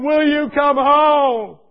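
high, loud, slow tearful pleading; weeping mother. Retold or imitated. A mother on the phone begging to know he is safe, tearful. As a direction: plead through tears for him. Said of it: "She's praying and crying".

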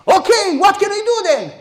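Joshua's plea, pitched high and loud; desperate Joshua. Acted. Joshua asks God what to do, pleading loudly. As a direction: cry out like a man at his wit's end.

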